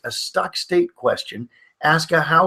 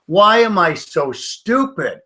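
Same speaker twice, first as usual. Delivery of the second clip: mock self-berating; 5 semitones higher, 4 dB louder, much slower than usual. Example of stuck state question.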